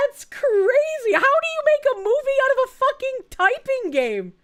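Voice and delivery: high, loud, incredulous; can't believe what you hear; stunned disbelief. Real, not acted. Disbelief at typing game.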